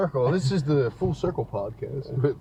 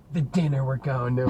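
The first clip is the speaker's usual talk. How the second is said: ordinary delivery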